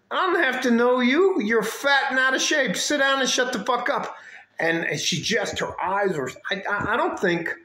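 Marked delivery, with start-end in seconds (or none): 0.1-4.2 high-pitched, animated dismissal; retold angry comeback. Man dismissing the woman at the bank, retold angry comeback. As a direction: act out a heated put-down, pitched up. His comeback in acted quarrel.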